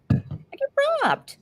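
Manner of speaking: very high, drawn-out squeal; high, slow mock complaint; mock outrage. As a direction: squeak it out as a playful grievance. Mock complaint, repeating herself.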